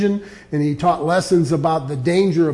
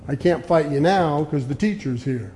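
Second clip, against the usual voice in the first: normal talking voice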